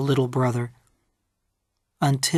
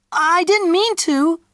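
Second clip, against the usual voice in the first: put-on much higher little-boy voice; child's protest; 13 semitones higher, 3 dB louder than usual. A narrator's reading. Peter to Mommy after switching brother off, pleading innocence.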